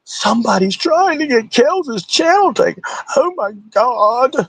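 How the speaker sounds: slow, high-pitched fake dismay; mock horror. Host parroting claim of channel takedown, mock horror. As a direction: draw it out in a hammed-up falsetto.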